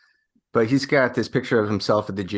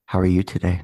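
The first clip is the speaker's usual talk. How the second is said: golf commentator; 3 semitones lower than usual. Pretend. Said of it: "do this whole episode like we're, like, golf commentators".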